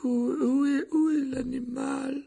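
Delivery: very high, stammering official; quavering voice. A narrator's reading. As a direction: stammer it out slowly, pitched far up. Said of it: "la voix chevrotante du membre de la commission". (voix chevrotante et aiguë, qui bégaie)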